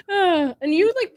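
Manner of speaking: cracking up; laughing through the words; laughing hard. Real, not acted.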